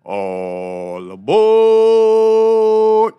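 long drawn-out high all aboard call; conductor calling. Pretend. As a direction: belt it out like a train conductor. Conductor calls out to board.

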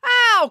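April yelping as Joe falls on her; sharp cry of pain. Acted. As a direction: yelp when landed on. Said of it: "cried April".

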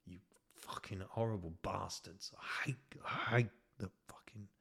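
hushed unvoiced whisper; whispered grumble. Real, not acted. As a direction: breathe it out under your breath. Muttered aside.

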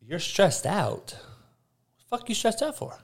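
quiet, high-pitched incredulous question; baffled by their excuse, pitch climbing high on the follow-up; mock disbelief. Coach needles people claiming to be stressed out, in mock disbelief. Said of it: "I'm like".

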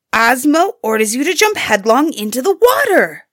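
high, loud storybook scream; fierce scream. A narrator's reading. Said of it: "turned on her fiercely and screamed".

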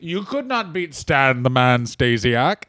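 slow old-champion impression; slow, mock-disappointed father; Bruno Sammartino. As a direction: scold slowly in character. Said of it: "it's Bruno, your father".

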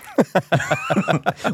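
loud high laugh; burst of laughter. Real, not acted. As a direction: break into a big laugh. Laughing at own bit.

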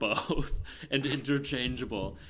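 ordinary talk, nothing marked

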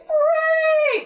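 high sustained trumpeted cry; trumpeting mule bray. A narrator's reading. Mule starts the animal band, high trumpeting. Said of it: "then the male trumpeted".

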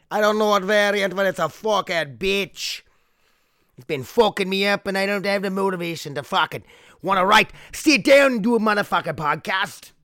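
high, loud, wandering mock-Irish rant; hammed-up Irish accent. Host moans about covid to listeners, hammed-up Irish accent. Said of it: "it started off Irish".

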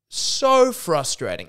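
slowly stretched, exasperated groan of words; deeply frustrated. Real, not acted. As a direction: drag out SO in exasperation.

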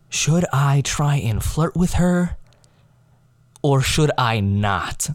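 slow, drawn-out deliberation; hammed-up thinking to himself, very slow; mock pondering. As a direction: stretch it out as if weighing options. Acting out his pondering thought.

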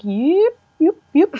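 high, loud yips; yipping. Retold or imitated. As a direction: yelp them out high with hands up. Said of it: "we were like".

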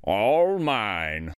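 low, grand gloating turtle king; lightly proclaimed royal boast; crying out, gloating. A narrator's reading. Yertle atop his new throne, crying out proudly. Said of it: "Yertle cried".

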